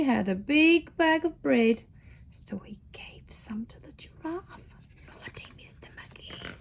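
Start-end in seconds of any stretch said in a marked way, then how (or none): 2.3-6.5 whispered, mock-scolding aside. Real, not acted. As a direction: whisper it like a secret. Reader's aside to child listener.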